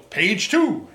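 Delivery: booming, definitive radio announcer voice, pitched high; Paul Harvey. Acted. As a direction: punch it out loud like an old-time radio host. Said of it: "in a very definitive radio voice".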